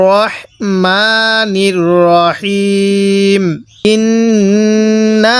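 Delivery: slow chanted tajweed with long held vowels; Quran recitation. Shown as a demonstration. Teacher shows students how to recite, slow chant. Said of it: "কিভাবে পড়ব?".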